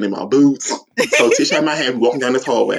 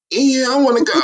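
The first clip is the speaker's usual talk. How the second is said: eager yes; 12 semitones higher than usual. Retold or imitated. Retelling his eager acceptance.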